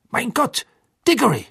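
high, shocked exclamation; understated horror in a high voice; horrified gasped words. A narrator's reading. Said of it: "flüsterte er".

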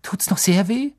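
put-on high, fussing girl's voice; admiring schoolgirl. A narrator's reading. Pansy asks Draco if it still hurts, high admiring concern.

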